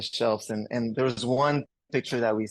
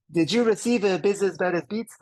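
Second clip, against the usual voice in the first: put-on eager French Canadian kid on the phone; French Canadian accent; 9 semitones higher, 5 dB louder than usual. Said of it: "with my French Canadian accent at the age of twelve".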